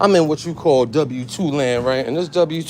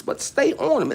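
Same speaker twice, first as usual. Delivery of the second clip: ordinary talk, nothing marked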